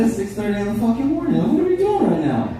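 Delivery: high, fast incredulous dad retort; exasperated. Retold or imitated. Dad snapping at his daughter about the early hour, high and fast.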